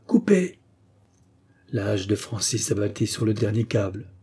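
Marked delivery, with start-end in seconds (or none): 0.1-0.6 high-pitched repeated command; shouted order. A narrator's reading. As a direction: call out the order. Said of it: "répéta le capitaine". (ordre crié)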